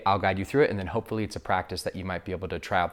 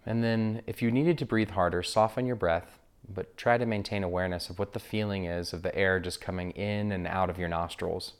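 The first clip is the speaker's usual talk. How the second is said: guided meditation. Acted. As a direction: guide listeners gently into breath awareness, unhurried.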